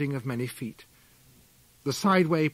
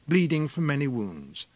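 usual speaking voice

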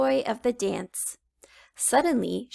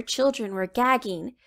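ordinary delivery

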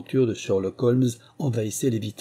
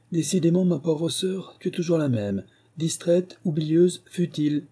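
higher-pitched, emphatic scolding; severe; 7 semitones higher than usual. A narrator's reading. Brother regaining composure to lecture his sister, severely. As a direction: sternly punch out each reproach. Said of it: "pour articuler sévèrement".

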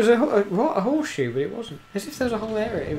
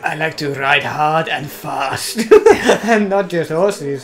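suggestive; 3 semitones lower than usual. Pretend. Voicing a character, slow and suggestive.

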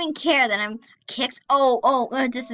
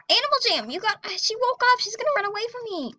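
whiny, much higher complaint; high-pitched whine; 10 semitones higher, faster than usual. Real, not acted. Player losing her target in the game, high whine.

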